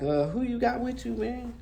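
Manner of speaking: put-on caller's question to the girl, high and alarmed; suspicious surprise. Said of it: "I'm like".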